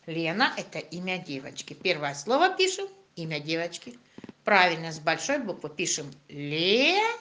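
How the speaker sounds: ordinary delivery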